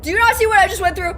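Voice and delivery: loud, high, fast protest; indignant outburst. Real, not acted. Player to demanding game character, indignant shout. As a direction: fire back in exasperated disbelief.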